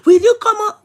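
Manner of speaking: high, loud mock outburst; imitated scolding. Daddy silencing his child's excuses, imitated scolding. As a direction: cut someone off like an exasperated dad. Acts out daddy cutting her off.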